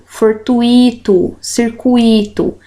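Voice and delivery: fortuito and circuito drawn out as for-tu-Í-to, cir-cu-Í-to; wrong-way fortuito and circuito with a long stressed í; stressed I hiatus. Shown as a demonstration. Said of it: "Não é assim que eu devo pronunciá-las".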